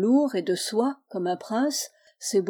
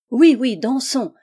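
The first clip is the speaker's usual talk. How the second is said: louder, eager cry all together; joyful cry; 3 semitones higher, 7 dB louder than usual. A narrator's reading. Village women cheering for a dance, joyful loud cry. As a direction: cheer it out like a happy crowd. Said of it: "s'écrièrent toutes les femmes d'une seule voix".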